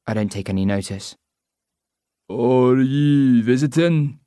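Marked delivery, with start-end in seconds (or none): 2.3-4.2 slow, loud pushy local; Scottish pub man. Acted. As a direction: lean in and drawl the question. Droopy chats her up.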